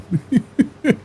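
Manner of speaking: short high giggle; a quick high laugh. Real, not acted. Laughing at numb lips.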